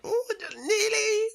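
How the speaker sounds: very high, slow, laughing wail; laughing despair. Retold or imitated. Fan mock-mourning a near callback, very high laughing wail. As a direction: wail it half laughing, half crying. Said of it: "I'm just like".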